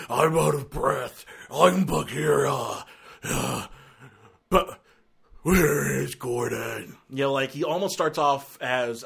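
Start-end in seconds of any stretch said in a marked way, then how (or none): 0.1-7.0 slow, breathy mock Batman rasp. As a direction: parody a gritty hero gasping his lines.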